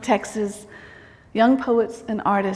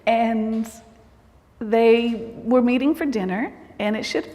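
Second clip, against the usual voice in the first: normal talking voice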